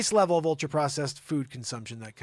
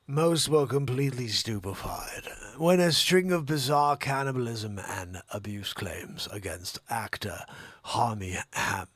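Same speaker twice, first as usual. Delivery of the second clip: put-on Jeremy Irons English accent; 4 semitones lower than usual. Host reads celebrity scandal in put-on English accent. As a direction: read it as a grand English actor. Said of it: "My English accent is essentially Jeremy Irons".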